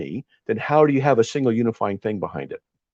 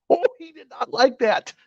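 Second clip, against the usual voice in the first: real laughter through the words, pitched way up; laughing. Real, not acted. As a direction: laugh while telling it. Laughing while retelling.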